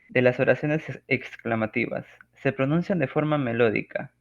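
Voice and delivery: ordinary talk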